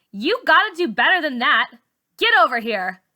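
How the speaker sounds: bossy demand. A narrator's reading. Char pressures Malika.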